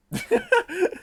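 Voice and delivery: high squeaky embarrassed laugh. Real, not acted. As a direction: laugh at yourself awkwardly. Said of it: "oh God, what am I talking like?".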